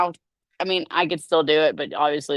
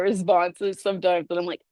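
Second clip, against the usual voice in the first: ordinary talk, nothing marked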